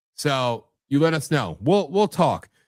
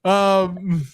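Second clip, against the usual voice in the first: hammed-up fake sob, much higher and louder; mock crying; 11 semitones higher, 5 dB louder than usual. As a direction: wail a pretend tearful goodbye. Fake sobbing farewell to co-host.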